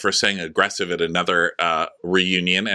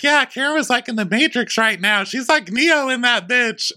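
giddy Ashley. Retold or imitated. Ashley joking Karen dodged like Neo, high giddy put-on voice. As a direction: gush it up high.

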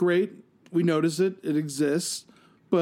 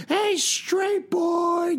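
high, drawn-out sing-out taunt; imitated teasing catcall; mock yelling; 14 semitones higher, much slower than usual. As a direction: holler it high, stretching every word. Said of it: "like, yelling at me".

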